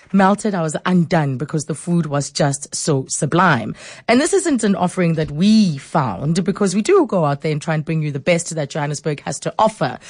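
normal talking voice

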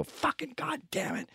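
high, strained curse at the pain; mock swearing at a stubbed toe. Man stubs his toe and curses, mock angry. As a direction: spit out the curse like you just stubbed your toe. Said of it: "I go".

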